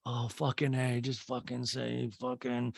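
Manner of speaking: soft, slowed exasperated grumble; weary muttering. Retold or imitated. Recapper watching a third time, quietly exasperated.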